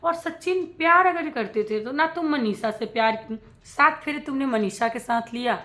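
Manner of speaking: normal talking voice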